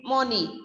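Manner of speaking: "money" said with an o, "MON-ee"; spelling pronunciation. Shown as a demonstration. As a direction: sound out "money" as written, "monny". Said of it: "logically it should be money".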